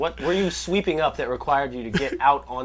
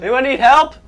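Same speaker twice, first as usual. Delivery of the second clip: calling out; 12 semitones higher, 11 dB louder than usual. Pretend. Calling out in empty game world.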